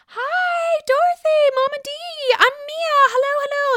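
very high, gushing mock hello; mock Mia greeting. Mia introducing herself to Mama Dorothy, very high mock greeting. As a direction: squeal out an over-friendly imitation. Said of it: "Mia's talking to Mama Dorothy".

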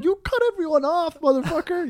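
indignant outburst. Retold or imitated. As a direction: sound outraged at a reckless driver. Outraged witness's imagined outburst.

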